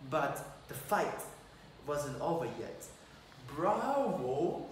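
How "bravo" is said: a hint of a villain's mocking cheer; lightly voiced sneering villain; evil voice. Mouse King mocks the prince from the shadows, lightly evil. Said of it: "such an evil voice from the shadow".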